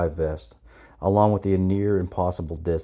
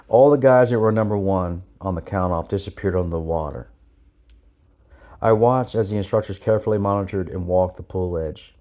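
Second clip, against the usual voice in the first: usual speaking voice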